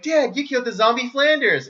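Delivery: put-on high, loud kid yelling; horrified boy shouting at dad; loud high cartoon kid outcry; shocked Bart. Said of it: "Bart's like".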